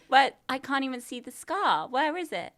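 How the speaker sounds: ordinary delivery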